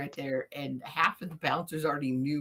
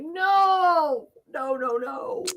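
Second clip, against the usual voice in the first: high, loud drawn-out wail, then quicker repeats; mock distraught protest; 10 semitones higher, 5 dB louder, much slower than usual. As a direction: wail it out as your friend gets kicked out. Retold protest at friend's ejection.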